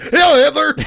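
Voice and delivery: high, very loud imitated yell; hammed-up shouted salute; sudden shout. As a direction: blurt it out as a startling mock shout. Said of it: "he just shouts at this woman's face".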